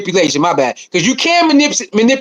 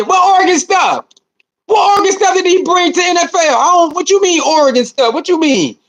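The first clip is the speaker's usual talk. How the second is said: incredulous; 7 semitones higher than usual. Real, not acted. Incredulous reply to viewer.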